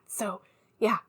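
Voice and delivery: hushed, secretive whisper; conspiratorial whisper to a friend. Acted. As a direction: whisper so Marcus can't hear. Secret chat away from Marcus.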